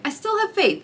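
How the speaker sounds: ordinary delivery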